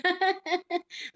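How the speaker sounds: short, high chuckle; soft high giggle; amused laugh. Real, not acted. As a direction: chuckle lightly at your own story. Amused by her own story.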